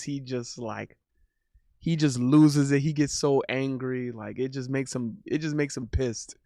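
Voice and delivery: ordinary talk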